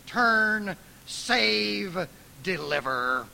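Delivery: loud, demanding pleas; urgent imperatives. Acted. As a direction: command God to act. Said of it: "speak imperatives".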